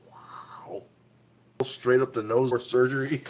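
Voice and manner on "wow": whisper. Real, not acted. Awed by a find.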